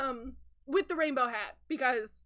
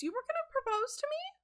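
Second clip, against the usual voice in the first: high, incredulous squeak of a question; stunned question; 12 semitones higher than usual. Acted. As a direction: voice shoots up in disbelief. Voices Peanut's stunned question.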